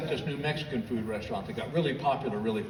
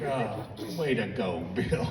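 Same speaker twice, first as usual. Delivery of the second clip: softer, lower, slow self-mockery; sarcastic self-scold; 6 semitones lower, 5 dB quieter, slower than usual. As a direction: mutter it to yourself, disgusted with yourself. Scolding himself after the fight.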